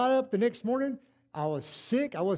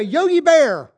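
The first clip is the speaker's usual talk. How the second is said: louder, higher blurt; comic blurt; 8 semitones higher, 14 dB louder, slower than usual. Retold or imitated. Preacher jokes about tongues, louder and higher. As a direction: pop it out as a joke. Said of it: "I thought I was gonna say".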